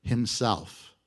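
himself dropped low and slow for weight; stressed final word. Shown as a demonstration. Preacher to congregation, himself said low and weighty. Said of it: "listen to this".

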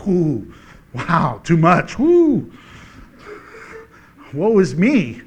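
hammed-up whining sigh; mock lament. Preacher mocking believers who complain about serving, whiny. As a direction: overplay the self-pity.

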